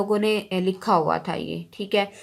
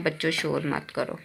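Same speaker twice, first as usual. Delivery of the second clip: soft sentence reading; 5 semitones lower, 7 dB quieter than usual. Shown as a demonstration. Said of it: "teacher repeats sentence softly".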